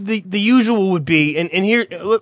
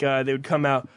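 usual speaking voice

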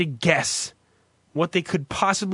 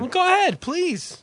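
sarcastic; 13 semitones higher than usual. Acted. Sarcastic mock politeness.

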